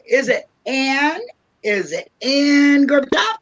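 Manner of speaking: drawn-out, rising guess; unsure guess. Real, not acted. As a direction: grope for the name, stretching it upward. Puzzling over a name, joking.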